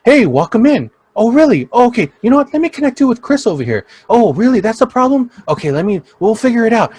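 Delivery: high, loud, peppy salesy voice; mock the too-eager assistant manager; hammed-up chipper greeter voice; overly energetic manager. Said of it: "he's just too, like".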